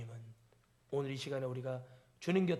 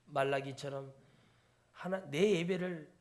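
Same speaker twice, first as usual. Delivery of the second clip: ordinary delivery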